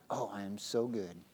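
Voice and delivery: low, soft self-satisfied murmur; mock self-admiration. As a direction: quietly admire yourself, mocking the proud worker. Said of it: "and you're like".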